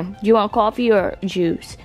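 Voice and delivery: usual speaking voice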